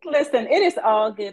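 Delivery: normal talking voice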